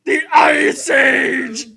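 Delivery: campy villain yell; hammed-up villain shout. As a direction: bellow it like a cartoon villain. Quoting Mr. Freeze line.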